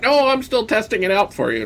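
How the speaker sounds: high smug big brother voice; teasing older brother. Retold or imitated. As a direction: hog the toy and tease.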